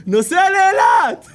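high, loud, slow cheer; triumphant shout. Real, not acted. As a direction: shout it like a victory.

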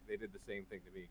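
usual speaking voice

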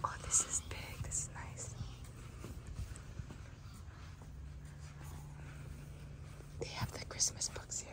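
soft whisper in the library. Real, not acted. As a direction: whisper so nobody is disturbed. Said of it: "Shh, library voice".

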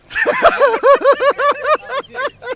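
high, loud peal of laughter; laughing fit. Real, not acted. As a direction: burst out laughing. Cracking up at a guess.

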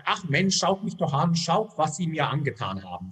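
ordinary delivery

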